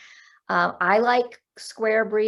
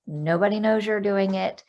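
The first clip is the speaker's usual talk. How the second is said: usual speaking voice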